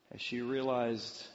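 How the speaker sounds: ordinary talk, nothing marked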